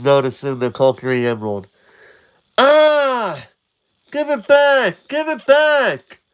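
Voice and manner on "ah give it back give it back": very high pleading shout. Acted. Link demands the emerald, very high shout. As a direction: yell for it back. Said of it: "Link's emerald taken".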